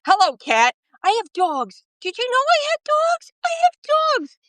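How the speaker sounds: screechingly high, singsong New York yelling; hammed-up TV judge shriek; Judge Jeanine Pirro. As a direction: pitch leaping up and down in exclamations. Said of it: "that's my Judge Jeanine".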